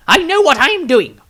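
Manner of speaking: loud, high sharp answer; defiant retort. Acted. Maestro talking back to Tobias, loud and defiant. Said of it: "talked back to him".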